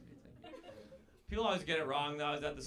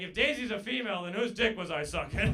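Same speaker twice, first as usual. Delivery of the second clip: slightly raised, punchy disbelief; mock outraged retort; 5 semitones higher, 5 dB louder than usual. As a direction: fire it back half-shouting in disbelief. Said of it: "I was like".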